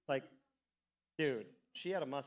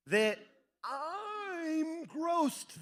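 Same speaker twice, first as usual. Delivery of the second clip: much higher, near-crying whine; whiny disgust; 10 semitones higher, much slower than usual. Retold or imitated. Guest at mom's dinner, whiny near-crying disgust. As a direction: whimper how grossed out you are.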